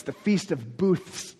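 "Booths" drawn out with an exaggerated th-s ending; slow right down for "Booths"; careful pronunciation. Shown as a demonstration. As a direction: overdo the final ths in "Booths". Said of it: "That's a hard word to say".

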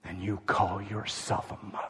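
low, soft, cold accusation; judging inner voice. Retold or imitated. The voice mothers hear judging them, low and cold. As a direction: murmur it like the voice of judgment. The voice of judgment heard.

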